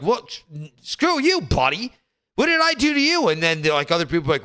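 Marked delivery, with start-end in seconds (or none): 0.0-3.3 high indignant comeback; mock outrage. Imitating a man pushing back at a stranger, high and indignant. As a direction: snap back like you've been wronged, pitched up. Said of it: "You're like".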